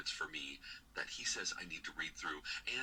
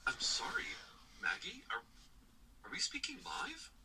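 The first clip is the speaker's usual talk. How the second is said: startled whisper; 10 semitones higher than usual. Acted. Caller startled, whispers to recording.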